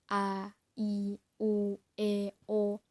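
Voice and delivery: A, I, U, E, O sounded separately and distinctly; open-mouthed clear vowels. Shown as a demonstration. Teacher demonstrating articulation for poem reading, clear open vowels. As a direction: open the mouth fully on each of "A, I, U, E, O". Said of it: "jadi mulutnya terbuka".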